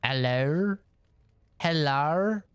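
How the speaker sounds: mock Australian "Hello?" as "el-lair", then "hel-lar"; imitated Australian accent. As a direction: try out a bad Australian "Hello?", drawn out with a final R. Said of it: "R's on the end of hello".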